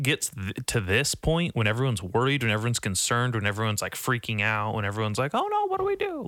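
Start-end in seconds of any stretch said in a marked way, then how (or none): 5.3-6.2 squeaky high mock worry; mock panic. Mocking worried people during the crisis, very high squeaky voice. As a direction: play a helpless panicker, way up high.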